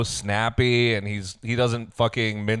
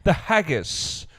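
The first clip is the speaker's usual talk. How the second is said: put-on Scottish accent; 11 semitones higher, 4 dB louder than usual. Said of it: "That's really good".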